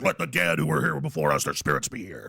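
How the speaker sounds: very low, ominous priestly invocation. Acted. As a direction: intone it deep like a cult priest. Acts high priest's solemn invocation.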